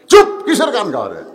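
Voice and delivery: mock telling-off, loud at the start; scolding. Grandfather shushes grandson who wants to sing, scolding. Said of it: "একটা ধমক দেয়".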